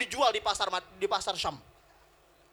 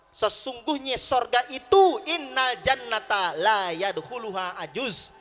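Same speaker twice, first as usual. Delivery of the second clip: slow, drawn-out, raised declaration; solemn pronouncement; 3 semitones higher, 5 dB louder, slower than usual. Retold or imitated. The Prophet answers his old aunt, slow pronouncement. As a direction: pronounce it gravely, word by word.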